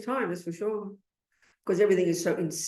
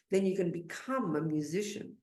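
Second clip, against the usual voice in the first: ordinary delivery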